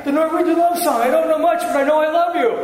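loud, very high comic recital; hammed-up Norwegian love song. Shown as a demonstration. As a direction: push the joke up high and loud. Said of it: "Let's try it again".

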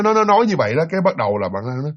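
normal talking voice

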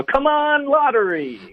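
high, drawn-out mock cheer; root for the lottery with stretched vowels; hammed-up cheer. Monica giving up on Chandler for lottery, mock cheer.

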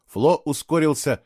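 usual speaking voice

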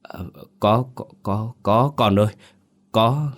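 halting, frightened stutter in broken bursts; stammering. A narrator's reading. Shaken father stammering to his son after seeing a ghost, stuttering. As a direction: stammer it out, breaking between repeated words. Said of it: "Ông cứ lắp bắp".

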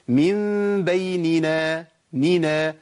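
مِن بَيْنِنا recited slowly, each نِ kept distinct and drawn out; nūns given full measure. Shown as a demonstration. Teacher showing students the example, measured recitation with both nūns distinct. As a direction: draw out من بيننا, every ن clearly separated. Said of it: "زي إيه؟".

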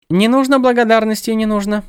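high, lilting birdlike goddess; sing-song, echoing her own words; bird goddess voice. A narrator's reading.

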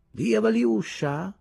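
high, slow, drawn-out languid voice; a limp, effeminate drawl rising at the end; languida ed effeminata. A narrator's reading. Thief from the gang calling up lazily, languid and effeminate. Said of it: "con voce languida ed effeminata".

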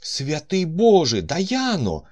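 high, loud amazed cry; exclaiming. A narrator's reading. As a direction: shout her name in wonder. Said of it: "вигукнув він". (вражено вигукує)

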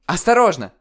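очень громко и высоко; крикни в тревоге; предупреждающий крик. Acted. (крик-предупреждение)

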